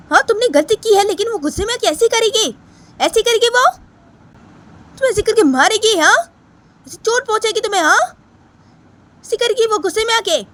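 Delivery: high-pitched indignant grandmother; angry scolding grandmother. Acted. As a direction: scold sharply, with rising challenging questions. (ऊँची आवाज़ में डाँटती दादी)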